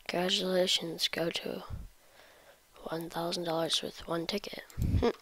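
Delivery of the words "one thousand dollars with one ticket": whispering. Real, not acted. Whispered reading.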